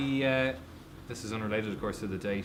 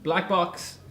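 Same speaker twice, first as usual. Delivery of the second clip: shouting; 7 semitones higher than usual. Real, not acted. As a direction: shout it across the room. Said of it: "don't have to shout".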